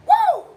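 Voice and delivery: very high, short whoop; playful high-pitched whoop; falsetto woo. Pretend.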